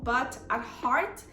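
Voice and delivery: ordinary talk, nothing marked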